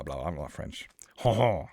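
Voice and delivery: ordinary talk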